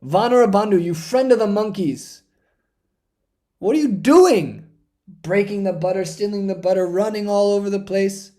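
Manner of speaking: exasperated scolding. Acted. Mother chasing her butter-stealing son, exasperated. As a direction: tell off a naughty child in exasperation. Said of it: "She's exasperated".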